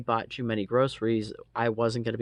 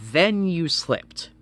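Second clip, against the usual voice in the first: slow, loud, high sarcastic drag; loud sarcastic jab, held out; sarcastic punchline; 7 semitones higher, 7 dB louder, slower than usual. Retold or imitated. Sarcastic end of retold rant.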